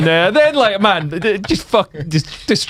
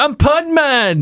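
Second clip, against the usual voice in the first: higher-pitched superhero catchphrase; superhero character; 8 semitones higher, much slower than usual. Retold or imitated. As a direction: announce yourself as a comic superhero. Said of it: "still trying to be like".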